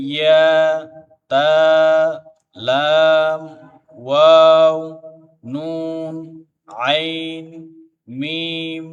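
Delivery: every letter stretched long on one steady note; letter-by-letter recitation. Shown as a demonstration. Teacher spelling out the joined letters for pupils, drawn-out recitation. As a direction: chant single letters evenly, pausing after each.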